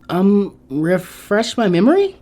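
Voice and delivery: sheepish Ino. A narrator's reading. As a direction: stall with an embarrassed smile.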